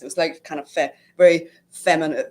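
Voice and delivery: usual speaking voice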